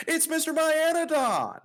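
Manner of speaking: high, loud showman's reveal; announcer hype reveal. Acted. Host unveiling first place to co-hosts, loud high hype reveal. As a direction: belt out the name like a ring announcer.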